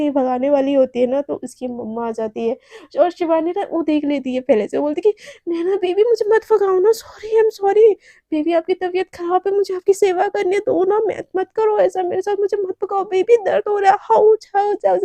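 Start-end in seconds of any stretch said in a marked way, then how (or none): 5.5-15.0 high, whiny put-on begging; fake pleading act. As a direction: ham up a pitiful victim for mom to hear. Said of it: "ऐसे ना वो नाटक करने लगती है". (ऊँची, रोनी सी नाटकीय मिन्नत)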